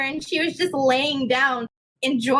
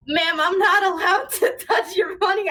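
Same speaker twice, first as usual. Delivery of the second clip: laughing while quoting; 3 semitones higher than usual. Retold or imitated. Telling off the customer, laughing.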